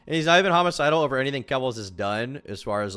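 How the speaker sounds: ordinary talk, nothing marked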